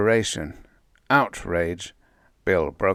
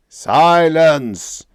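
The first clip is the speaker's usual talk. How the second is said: loud, higher, furious bellow; furious roar; 8 semitones higher, 11 dB louder than usual. A narrator's reading. Cosmic Voice cutting Bill off, furious roar. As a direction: roar them into silence. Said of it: "the word roared out furiously".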